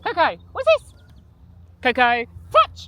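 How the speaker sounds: high sing-song call to the dog; loud, bright dog command; talking to a dog. Real, not acted. Calling the dog to touch.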